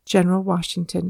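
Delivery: usual speaking voice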